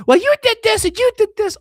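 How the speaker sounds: very high, loud accusing wife voice; mock angry wife. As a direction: snap back as the furious wife. Voicing angry wife in argument.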